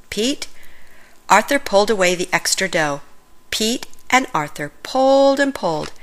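plain reading voice

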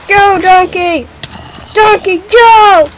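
loud, high, drawn-out yell; stretched-out shouted command, rising in force; exasperated shouting. Real, not acted. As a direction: holler slowly at a mount that won't move. Urging the donkey to go.

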